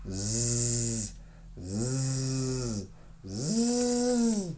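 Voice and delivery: soft, drawn-out pretend snores; mock snoring. Teacher reading a story to children, soft mock snoring. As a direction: snore it out gently for the kids.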